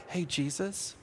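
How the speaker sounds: very soft, tentative mock nudge; hushed, overly polite wake-up; gentle waking. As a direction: softly coax a sleeper awake. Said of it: "and it wasn't like".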